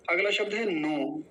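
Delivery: normal talking voice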